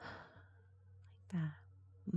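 soft sharp gasp; tiny breathy gasp; demo gasp. Shown as a demonstration. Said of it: "your breath might go".

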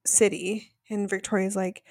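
ordinary delivery

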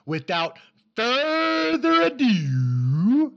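slow drawn-out ring announcer call; announcer hype intro. Acted.

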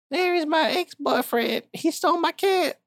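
high voice, alarmed at the name; dismayed woman. Acted. Woman hears her ex stole the cat, high and dismayed. Lady explains ex took cat.

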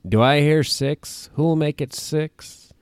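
slow, high auctioneer call. Retold or imitated. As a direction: call the bids like a pirate auctioneer. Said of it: "a now extinct line from an attraction".

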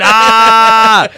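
long, high, loud "aah" yell; mock battle scream. Joking about firing your tank in the air, mock battle scream. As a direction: belt out a mock gunner's yell.

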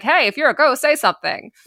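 ordinary delivery

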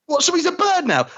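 high, incredulous mock voice; high mock disbelief; Ben impression. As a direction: scoff at the new Doctor. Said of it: "that whole thing of".

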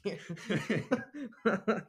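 loud, high, drawn-out laughing exclamation; helpless laughter through the words. Real, not acted. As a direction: crack up while saying it. Cracks up over the word.

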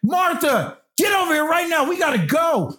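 put-on high shouted call to the kids; yelling child's full name. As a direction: yell across the hotel room. Calling family to leave.

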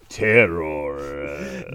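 slow, low sinister genie; Wishmaster voice. Shown as a demonstration. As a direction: drag out terror like the genie. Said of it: "You said terror like you were Wishmaster".

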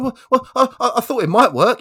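sheepish hunter. Acted. Hunter caught out by the bear, a high stammering excuse.

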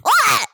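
sudden sharp scream; a startled yelp, high and loud. Acted. GM voices scream of falling body.

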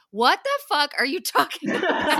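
high, loud, laughing disbelief; incredulous outburst. Retold or imitated. Retelling a castmate's reaction to Simon's speech, incredulous outburst. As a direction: burst out in baffled, mock outrage. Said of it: "And Bryn says".

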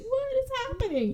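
high, thin bewildered voice; high confused voice, just before being stabbed. Retold or imitated. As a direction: ask it high, lost and dazed. Retold bewildered victim.

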